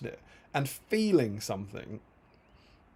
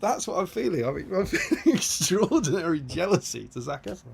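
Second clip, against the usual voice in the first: laughing confession; 5 semitones higher than usual. Retold or imitated. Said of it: "And I was like".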